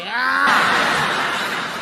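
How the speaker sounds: high mock yell with a laugh; a laughing imitation of the attacker's war cry. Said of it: "He was like".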